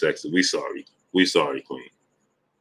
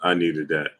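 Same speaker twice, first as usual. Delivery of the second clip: normal talking voice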